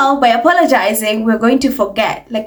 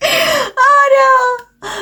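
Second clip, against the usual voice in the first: laughing wail. Real, not acted. Laughing cry at tiara quote.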